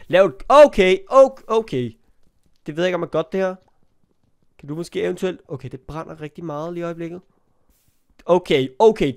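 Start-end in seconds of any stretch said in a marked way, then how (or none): none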